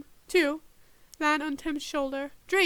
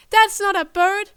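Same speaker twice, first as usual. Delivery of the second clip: loud, higher snarl; 5 semitones higher, 11 dB louder than usual. A narrator's reading. Agent snarling at Tim, angry. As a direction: snarl back in contempt. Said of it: "the agent snarled".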